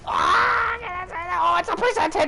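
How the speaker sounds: high frantic yelps in a boss fight; panicked shouting. Real, not acted. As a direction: scream in panic, laughing through it.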